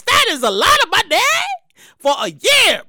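normal talking voice

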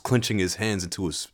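usual speaking voice

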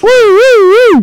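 high loud siren wail; siren imitation.

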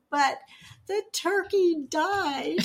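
mock tearful child voice; little girl's lament. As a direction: play the four-year-old niece, slow and tearful, half laughing. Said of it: "She said".

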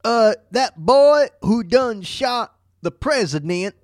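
slow, high-pitched Southern snitch voice; Southern accent. Retold or imitated. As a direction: play a folksy Southern informant, slowly. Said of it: "That sounds like a real Southern name".

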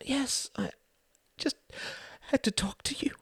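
high, soft and tearful; crying wife on a long-distance call. Acted. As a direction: choke it out through tears. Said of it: "she was crying".

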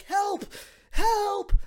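high-pitched re-enacted yell; mock cry for help. Boy yelling to cars driving by, imitated cry for help. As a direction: call out high to passing cars. Retold cries to passing cars.

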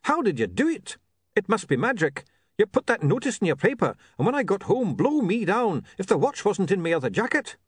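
excited gushing thanks. Acted. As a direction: gush like a man whose lost watch turned up. Grateful man gushing over watch.

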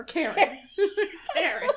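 ordinary talk, nothing marked

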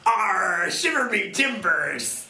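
loud, high pirate growl drawn out long. Acted. Co-host greets the host as a pirate, hammed-up pirate voice. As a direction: roar it like a storybook pirate. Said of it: "talking like a pirate now".

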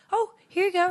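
high, put-on courteous voice; awkwardly polite retelling. Retold awkward delivery to naked customer.

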